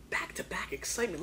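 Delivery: very soft, voiceless teaser; whispered hype; hushed whisper. Real, not acted. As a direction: whisper it like a secret build-up. Hushed build-up before the finish.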